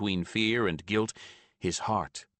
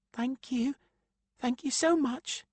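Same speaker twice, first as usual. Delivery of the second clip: put-on high, light young girl voice; lightly voiced young girl; girl's voice. A narrator's reading. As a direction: voice an eager girl. Macy thanking Kalira.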